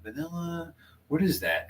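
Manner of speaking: re-enacted tentative pondering; retold puzzled musing. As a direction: quietly puzzle it out, as if thinking aloud. Retells own puzzling over the flavour.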